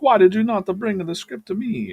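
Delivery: high, loud mock Italian accent. Producer demanding his script from a writer, Italian accent. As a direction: put on a big, high-pitched Italian accent. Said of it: "like, a real Italian accent".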